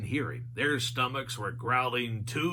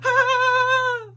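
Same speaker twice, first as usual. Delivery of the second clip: very high, loud shriek; high scream; 9 dB louder than usual. A narrator's reading. Shaggy screaming at the monsters, very high and loud. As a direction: shriek in cartoon terror.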